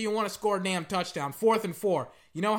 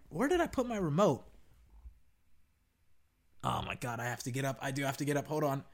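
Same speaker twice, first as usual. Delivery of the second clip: normal talking voice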